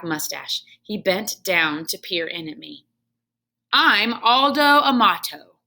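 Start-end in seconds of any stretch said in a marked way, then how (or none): 3.7-5.6 louder, hearty janitor voice; jolly janitor. Acted. Janitor Aldo greeting the hamster heartily. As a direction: introduce yourself with a big grin.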